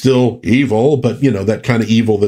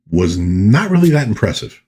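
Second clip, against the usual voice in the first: ordinary talk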